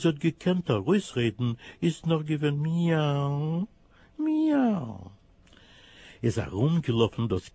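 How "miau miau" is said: high mewing meow; plaintive kitten meows. Acted. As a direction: mew like a hungry kitten. Kitten mews in story.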